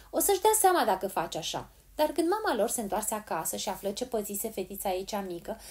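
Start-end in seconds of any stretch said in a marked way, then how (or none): 0.1-1.7 firm warning, a little higher. A narrator's reading. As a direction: warn firmly with some force. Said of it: "spuse Polly ferm". (avertisment ferm)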